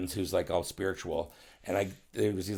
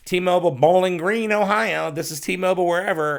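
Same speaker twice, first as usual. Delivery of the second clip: bright mock phone greeting, pitched higher and louder; higher sing-song receptionist voice; perky store caller; 8 semitones higher, 5 dB louder than usual. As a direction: play a chirpy shop clerk cold-calling. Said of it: "So they would call and go".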